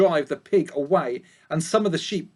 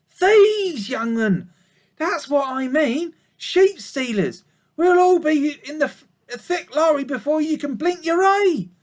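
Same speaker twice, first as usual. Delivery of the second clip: put-on high, louder old ewe; old sheep voice; 10 semitones higher, 4 dB louder than usual. A narrator's reading. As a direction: chat like a gruff old sheep. Ma warns Babe.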